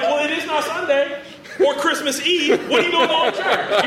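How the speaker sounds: lightly voiced husband, laughing and incredulous; half-laughing mock disbelief. Husband mocks her going to church.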